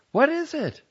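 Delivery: high, loud call; calling back. A narrator's reading. Wife calling back to husband, high and loud. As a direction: call back while holding on to calm. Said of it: "she called, trying to remain calm".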